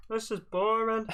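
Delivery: high, slow bored whine; slow, high mock Andy complaint. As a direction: drag it out, bored and childish.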